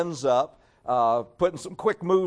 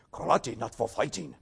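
put-on Japanese accent, lower and softer; quiet mock Japanese-accented English; Mr. Miyagi's Japanese accent; 5 semitones lower, 6 dB quieter than usual. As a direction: do a best-attempt Okinawan Japanese accent. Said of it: "in my best Okinawan Ju-, uh, Japanese accent".

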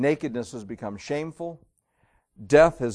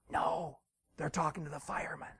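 put-on very soft, hushed child; little kid's voice; 3 semitones higher, 11 dB quieter than usual. Little kid tells boss about the fireman, hushed. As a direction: answer in a tiny secretive voice. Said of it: "this little kid's voice".

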